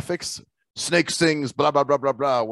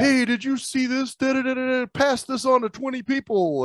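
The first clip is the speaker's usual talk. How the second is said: mock chain-message voice; 11 semitones higher than usual. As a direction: drag it out high like an inane forwarded message. Mocks chain messages from inbox.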